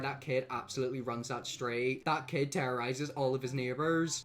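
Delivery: ordinary talk, nothing marked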